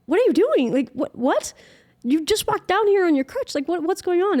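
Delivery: high, loud, disbelieving sister; shocked sister. Retold or imitated. Sister Chelsea startled to see her walk, high and amazed. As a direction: squeal it out in shock.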